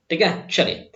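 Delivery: ordinary talk, nothing marked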